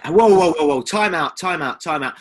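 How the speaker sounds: loud, insistent call for time out; loud interruption. Real, not acted. Podcaster halts co-host after the Essex jibe, loudly insistent. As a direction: cut in forcefully and loudly.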